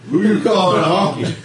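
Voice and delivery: mock offended.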